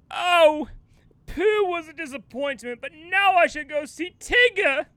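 high, theatrical storybook voice; storybook actor. Acted. Actor moving on to the next animal, hammy. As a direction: move grandly from regret to resolve. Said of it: "maybe he'll be like".

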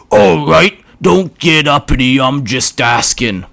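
a brutish orc taking his time. Acted. Orc voiced.

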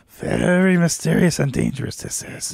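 lightly voiced grave Yoda; a hint of ominous Yoda. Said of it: "he's like".

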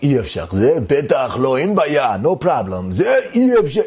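exaggerated high Israeli accent. Storyteller mimicking how they say it in Israel, hammed-up Israeli accent. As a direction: imitate an emphatic Israeli, pitch swooping way up. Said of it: "they say in Israel".

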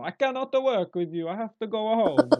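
loud, high mock Italian protest; hammed-up Italian complaining loudly; Italian accent. Italian worker protesting to his mother-in-law, mock Italian accent. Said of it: "they get shouted at in Italian".

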